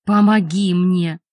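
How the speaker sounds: slow, loud inhuman bellow; inhuman roar. A narrator's reading. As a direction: roar like a beast half turned. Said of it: "ревел ... нечеловеческим голосом".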